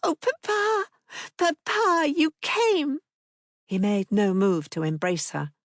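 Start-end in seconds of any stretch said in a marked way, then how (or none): 0.0-3.0 put-on high, eager child greeting her father; a delighted small girl calling out; little girl voice. A narrator's reading. Little girl greeting Papa, high and eager.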